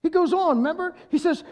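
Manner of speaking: normal talking voice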